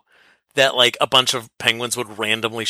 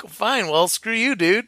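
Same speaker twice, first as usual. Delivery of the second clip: higher-pitched, huffy brush-off; mock dismissive; 8 semitones higher, slower than usual. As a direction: act out a fed-up kiss-off, pitched up. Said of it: "He's just like".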